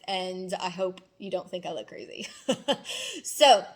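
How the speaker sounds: self-conscious laugh. Real, not acted. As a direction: giggle through the words. Laughing at her own lips.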